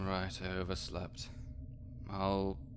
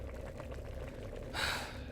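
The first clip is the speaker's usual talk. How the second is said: long soft unvoiced sigh of defeat; exasperated sigh; 9 dB quieter than usual. Necromancer with no clean mug, weary sigh. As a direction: let the air out, fed up. No clean mugs, too early.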